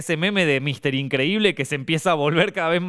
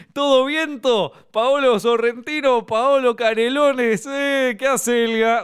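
higher, louder, slow cheesy greeting; a corny uncle at the door; the joker arriving at your house, high and slow; cheesy old joker; 8 semitones higher, 3 dB louder, slower than usual. Retold or imitated. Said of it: "ese personaje".

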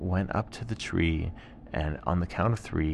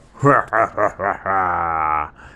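a light, loud chuckle; tiger laugh; 7 dB louder than usual. Acted. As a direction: chuckle along. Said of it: "the tiger laughed as well".

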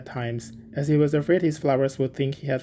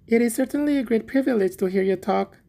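higher-pitched, admiring little Hans; humble little Hans; 7 semitones higher than usual. A narrator's reading. Hans admires the miller's talk on friendship, humble. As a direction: flatter humbly in a high character voice.

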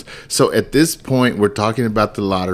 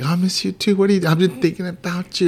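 high, sugary lovestruck voice; mock sweet reply; 9 semitones higher, faster than usual. Husband mimics his own lovestruck reply. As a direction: gush back like a smitten teen.

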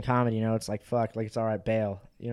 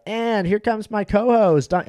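announcer voice; 9 semitones higher, 8 dB louder, slower than usual. Pretend. Mock host intro on stage.

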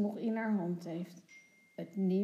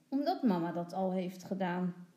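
plain reading voice

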